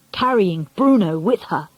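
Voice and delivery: ordinary delivery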